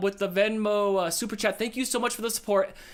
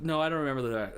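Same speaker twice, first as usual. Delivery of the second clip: ordinary delivery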